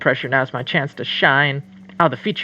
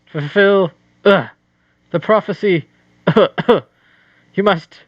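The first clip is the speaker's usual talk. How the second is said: hammy choking ghost, higher, louder; overacted death scene; 4 semitones higher, 3 dB louder than usual. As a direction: splutter out the last words. Ghost coughing dying.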